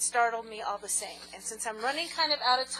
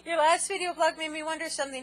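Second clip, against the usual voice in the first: ordinary talk, nothing marked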